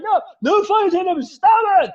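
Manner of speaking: high, loud shouted objection; scolding audience member. Acted. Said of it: "Be like".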